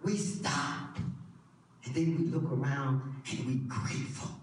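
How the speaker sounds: low, soft preaching; quiet, intimate sermon reflection; hushed sermon voice. Acted. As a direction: draw the church in close and quiet.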